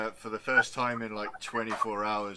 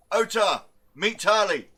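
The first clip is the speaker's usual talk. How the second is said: much higher, much louder call before running off; shout out; 10 semitones higher, 10 dB louder than usual. Acted. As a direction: yell the introduction across the room as he leaves. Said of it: "he will shout out first".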